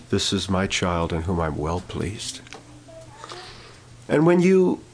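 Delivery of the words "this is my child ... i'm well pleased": lower, slow, softer loving blessing; a gentle, slow hint of God's fond voice; tender approval. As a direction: say it like a proud father to his child. Voicing God's approval softly.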